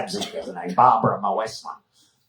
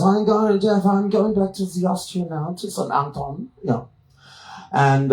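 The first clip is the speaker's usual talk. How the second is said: mock Austrian woman. Long Babsy speech, Austrian voice.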